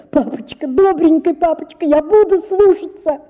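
высокий плачущий крик; догонять и умолять; плача, кричит. A narrator's reading. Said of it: "кричала я". (девочка плача кричит вслед отцу, высоко)

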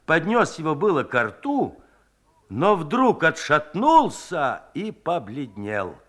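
plain reading voice

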